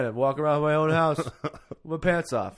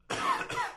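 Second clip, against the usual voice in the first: chesty cough; 5 dB quieter than usual. Real, not acted. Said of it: "Excuse me. Tickle in my throat".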